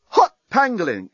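high-pitched startled exclamation; journalist's shocked outburst; exclaimed. A narrator's reading. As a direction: blurt it out in alarm. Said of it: "exclaimed the journalist".

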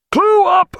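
high shout above gunfire. A narrator's reading. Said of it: "he shouted above the thunder".

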